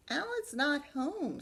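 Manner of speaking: high, slow polite mother; mom voice. Acted. Brain's mom telling the kids he is out, high polite. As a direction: answer the door politely.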